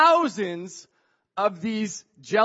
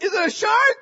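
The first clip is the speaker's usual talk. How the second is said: high, loud yell of alarm; acted-out frightened call; panicked shouted question; 12 semitones higher, 7 dB louder than usual. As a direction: shout the question across the waves. Panicked question yelled to friend.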